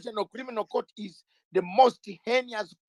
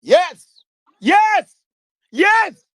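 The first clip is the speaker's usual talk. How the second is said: loud, high shouted yes; emphatic yes; 10 semitones higher, 9 dB louder, much slower than usual. Real, not acted. Guest to host, shouting yes with loud emphasis. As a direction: belt each yes out.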